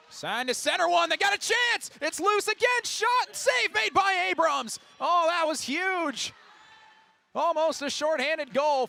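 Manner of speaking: high, urgent shouted call of a big chance; real excitement, voice jumping up for the save; excited play-by-play. Real, not acted. Commentator calls a loose puck chance and save, excited.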